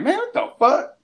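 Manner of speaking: falsetto disbelief; very high, comic bewilderment; shocked reaction. Retold or imitated. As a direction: squeal it like you just got slapped.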